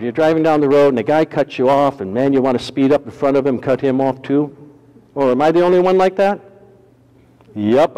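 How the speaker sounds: normal talking voice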